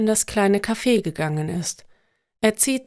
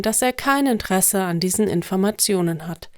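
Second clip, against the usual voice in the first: plain reading voice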